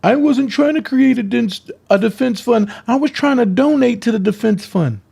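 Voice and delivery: high whiny mock of her excuse; sarcastic high imitation. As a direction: mimic her lame excuse, pitched high. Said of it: "And you said".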